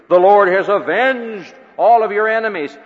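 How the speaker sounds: loud, high, triumphant cry of victory news; triumphant messenger. Acted. Messenger bursts in on the king with loud victory news.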